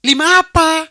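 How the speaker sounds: high, loud disbelieving cry; shocked shout. Retold or imitated. Fiancée shrieks in disbelief at the small sum. Other person demands an answer.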